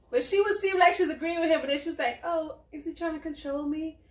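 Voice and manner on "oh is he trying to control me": softer, doubting imitation of her; lightly voiced anxious woman; mock worried girlfriend. She second-guesses his advice, a softer mock-worried voice.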